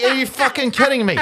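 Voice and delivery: high, loud, outraged outburst; retold rage. Viewer recounting fury at the film, outraged and incredulous. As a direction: re-enact your fury at full pitch. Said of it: "Like the, the rage".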